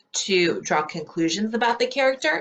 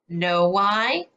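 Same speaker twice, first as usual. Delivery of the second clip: ordinary delivery, nothing marked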